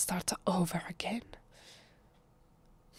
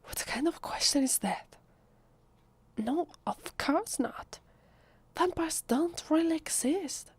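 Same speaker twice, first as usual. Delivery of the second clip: higher-pitched, taken-aback protest; incredulous denial; 8 semitones higher than usual. Acted. Vampire denying to a curious visitor that vampires exist, incredulous. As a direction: protest as if the question is absurd.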